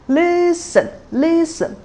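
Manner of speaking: "listen" very high and held, then dropping low; high-low tone pattern. Shown as a demonstration. Teacher modelling tones one and six, "listen" high then low. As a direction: exaggerate the pitch step in "listen". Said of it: "tone one just sound like the stressed syllables".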